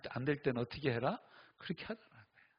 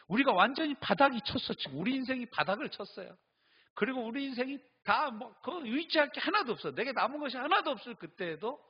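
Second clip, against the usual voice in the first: rising preaching; 10 semitones higher, 4 dB louder than usual. Acted. Preaching climax.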